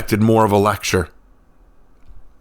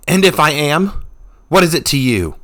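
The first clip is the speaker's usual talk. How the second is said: higher, louder defiant snarl; 7 semitones higher, 4 dB louder than usual. A narrator's reading. Revna snarls back at the smiling stranger. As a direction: bite back at him. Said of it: "She snarled".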